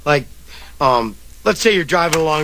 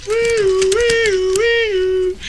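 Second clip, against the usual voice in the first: high imitated siren wail; sustained high mouth-made siren; ambulance siren; much slower than usual. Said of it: "In comes a meat wagon".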